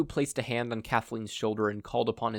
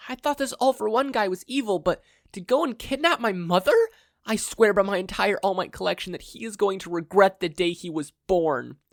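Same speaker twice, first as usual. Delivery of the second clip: high, loud, heated rant; angry boy swearing revenge; furious rant; 10 semitones higher, 6 dB louder than usual. Acted. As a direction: vow revenge at the top of your voice. Izuku ranting about kidnapped mother.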